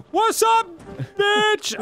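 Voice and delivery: high-pitched loud holler; mock shouted greeting. Player hailing his boy in the game, shouted greeting. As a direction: call out big and high, playfully brash.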